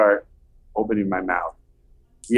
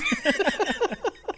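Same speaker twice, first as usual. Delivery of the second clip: high laugh; 6 dB quieter than usual. Real, not acted. Laugh at punchline.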